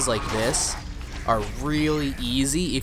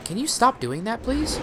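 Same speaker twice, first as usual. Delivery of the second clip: exasperated plea; 11 semitones higher than usual. Real, not acted. Pleading with the darkness.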